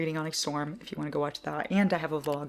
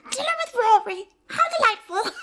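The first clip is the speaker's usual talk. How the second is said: high, loud posh quoting voice, much higher pitch; Lorelai quote; 7 dB louder than usual. Retold or imitated. Quoting Lorelai to viewers, high posh voice. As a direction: toss it off like a grand lady. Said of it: "When Lorelai goes".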